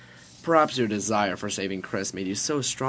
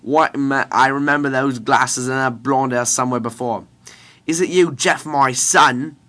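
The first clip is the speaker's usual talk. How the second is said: loud, higher hammy British scientist; bad British accent; 4 semitones higher, 12 dB louder than usual. Scientist recognizes his son, bad British accent. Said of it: "I'm doing a British accent".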